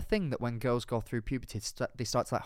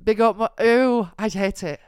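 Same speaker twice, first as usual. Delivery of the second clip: disgusted; 8 semitones higher, 9 dB louder than usual. Real, not acted. Disgusted at something on screen.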